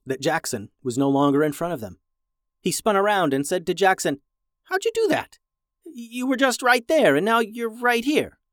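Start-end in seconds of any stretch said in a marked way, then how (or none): 4.6-8.4 high-pitched, baffled disbelief; amazed kid, voice shooting way up; astonished. Acted. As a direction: react as if he just teleported. Brother amazed by sudden vanishing.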